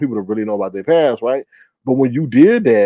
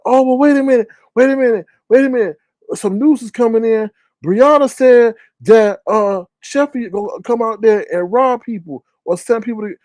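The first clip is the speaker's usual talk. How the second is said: raised pitch, mock urgent interruption; mimicked breaking news; 8 semitones higher than usual. Host mimics man announcing news is coming in, urgent. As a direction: imitate a man halting everything for incoming news. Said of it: "So he's like".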